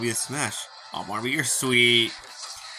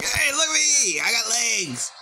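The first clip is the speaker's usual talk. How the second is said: high, excited little creature; squeaky high creature voice; 11 semitones higher, 3 dB louder, slower than usual. Acted. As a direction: squeal it like a tiny pet. Voicing a creature.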